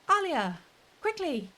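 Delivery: high, urgent call to Alia. Acted. Huang urgently calling Alia to hurry, high voice. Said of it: "I can't remember if I used a voice thing".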